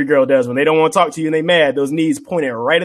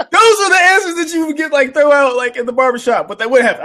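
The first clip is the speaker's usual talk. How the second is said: talking through laughter, higher and louder; laughing; 13 semitones higher, 5 dB louder than usual. Real, not acted. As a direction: still cracking up while explaining.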